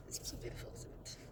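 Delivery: breathy hush of self-admiration; whisper. Real, not acted. Whispering to the viewer about her own hair. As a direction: whisper it like a secret compliment.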